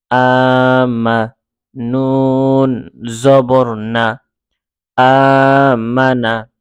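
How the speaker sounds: slow, drawn-out spelling chant; name each letter and sign, then join them slowly. Shown as a demonstration. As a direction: hold each syllable long and level. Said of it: "এভাবে আমরা বানান করে পড়ব".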